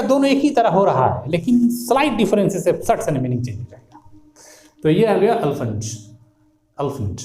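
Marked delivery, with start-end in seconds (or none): 5.3-7.2 "अल्फेंट" as uhl-funt, middle E dropped; tongue curling round in "अल्फेंट"; rounded two-syllable elephant. Shown as a demonstration. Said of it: "sound जो गोल हो जाएगा".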